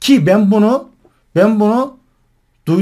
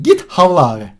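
usual speaking voice

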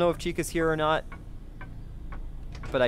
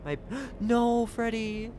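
dismayed gasp; 8 semitones higher, 3 dB quieter, slower than usual. Real, not acted. Dismay at Freddy's fate.

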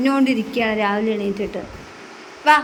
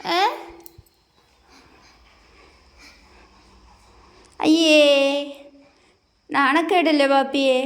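put-on higher, sing-song voice soothing a crying toddler; coaxing baby talk; 5 semitones higher than usual. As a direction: coax the little one to stop crying.

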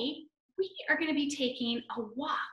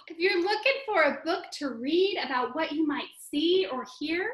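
usual speaking voice

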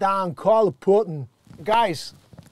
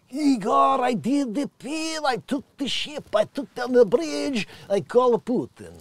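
higher, lightly hammed Russian voice; mock Russian accent; 6 semitones higher than usual. Igor boasting to himself on the ship, mild Russian accent. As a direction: boast like a drunk Russian sailor. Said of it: "Igor voiced in story".

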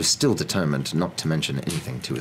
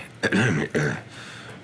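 nervous throat-clearing; 3 semitones lower than usual. Acted. Husband about to question wife, nervous throat-clearing. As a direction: clear the throat before a tricky subject. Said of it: "He cleared his throat nervously".

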